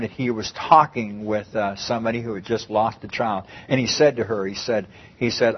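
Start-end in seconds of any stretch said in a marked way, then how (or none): none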